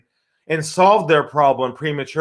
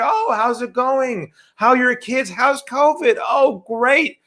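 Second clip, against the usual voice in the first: fake small talk; 8 semitones higher than usual. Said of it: "And here you".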